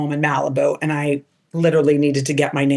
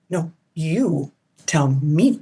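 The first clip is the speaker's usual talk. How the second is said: drawn-out coy reply; much slower than usual. Retold or imitated. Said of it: "I'm like".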